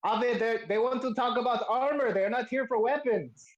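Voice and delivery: high-pitched insistent character voice; smith calling back. Acted. Smith tells armorer customers want armor, insistent call. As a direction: call an explanation through to your partner. Smith calls instructions to partner.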